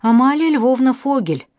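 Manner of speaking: formal, loud announcement of a full name; official tone. A narrator's reading. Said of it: "официально сказал майор". (майор официально называет задержанную, громко)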